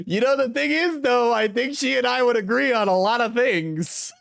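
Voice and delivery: very high, laughter-squeezed voice; fighting back a real laugh; stifling laughter. Real, not acted. Streamer agreeing with the woman in the video, stifling laughter.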